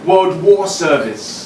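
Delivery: spoken word poem. Acted. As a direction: deliver each line to the room like a slam poem. Performs his spoken-word poem.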